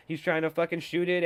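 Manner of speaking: usual speaking voice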